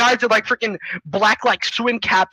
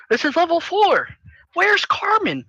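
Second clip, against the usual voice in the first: put-on high-pitched kid's complaint; hammed-up childish dismay; whiny confusion; 8 semitones higher than usual. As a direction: ask in a high, bewildered voice. Character confused at empty level.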